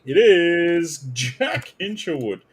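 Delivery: hammed-up slow announcement; mock reveal. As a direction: draw the name out like a game-show reveal. Dramatic player reveal.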